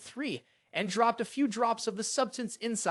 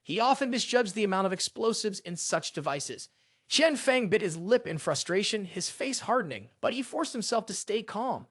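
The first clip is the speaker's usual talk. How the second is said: ordinary delivery, nothing marked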